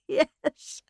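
high yes through laughter; laughing agreement. Acted. Norman's wife joining in the laughter, laughing. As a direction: laugh out the word. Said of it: "joined in the laughter".

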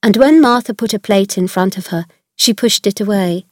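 ordinary delivery

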